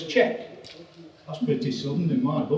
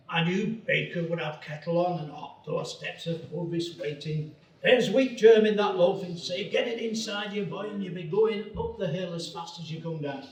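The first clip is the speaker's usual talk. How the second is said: put-on old voiceover in a funny regional accent; hammed-up rustic British accent; comedic parochial accent. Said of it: "funny accents".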